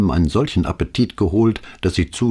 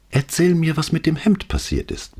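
pleading; 11 semitones higher than usual. A narrator's reading. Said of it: "bat sie".

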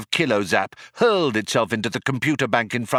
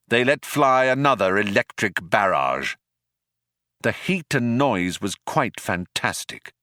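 usual speaking voice

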